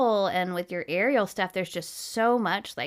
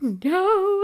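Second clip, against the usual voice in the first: crying no; 12 semitones higher, 6 dB louder than usual. Retold or imitated. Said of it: "And I'm, like, crying".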